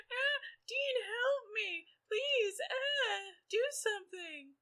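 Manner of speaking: high, crying mock plea; mock damsel cry. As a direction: wail for help like a helpless damsel. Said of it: "'Cause she's like".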